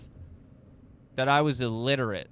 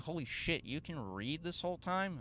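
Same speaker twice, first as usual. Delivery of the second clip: hushed, higher-pitched mock disbelief; imitated astonishment; 5 semitones higher, 8 dB quieter than usual. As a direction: imitate a stunned teacher, soft and high. Acts out astonished teacher learning truth.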